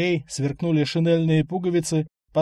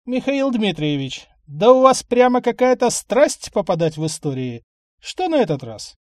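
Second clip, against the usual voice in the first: amused, teasing, higher and louder; ironic greeting; 5 semitones higher, 4 dB louder than usual. Acted. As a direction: tease him with a wry welcome. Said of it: "Иронично поприветствовал меня пристав".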